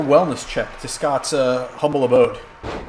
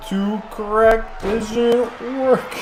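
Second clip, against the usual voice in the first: very slow, higher drawn-out voice; slow motion voice; 7 semitones higher, much slower than usual. Pretend. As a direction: drag every word out in slow motion. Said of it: "I'm giving him a slow motion, uh, voice".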